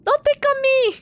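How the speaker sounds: high, loud squealing protest. Acted. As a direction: squeal like you're being tickled. Squealing while being tickled.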